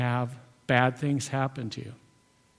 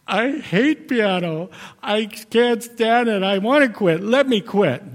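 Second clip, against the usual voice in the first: imitating a crying child; 8 semitones higher, 6 dB louder than usual. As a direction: wail it like a tearful child begging to stop piano lessons. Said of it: "they're crying and they say".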